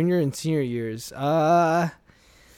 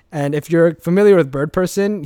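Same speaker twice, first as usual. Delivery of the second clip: ordinary talk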